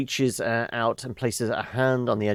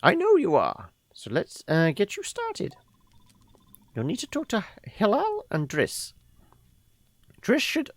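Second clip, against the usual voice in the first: put-on high, airy old woman voice; a fond grandmother reminiscing; old lady voice; 4 semitones higher than usual. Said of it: "So we should do the old lady voice".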